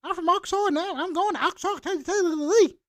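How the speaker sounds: high, loud imitation of a country girl's accent; hammed-up high country twang. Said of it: "that shorty country accent".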